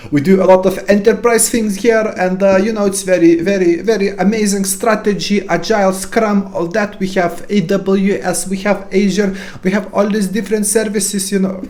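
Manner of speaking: hammed-up Indian accent rattling off tech buzzwords; playful fake Indian accent, pitched well up. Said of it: "Indian accent?".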